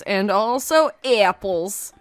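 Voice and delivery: ordinary delivery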